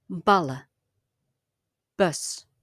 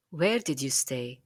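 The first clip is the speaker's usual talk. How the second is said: normal talking voice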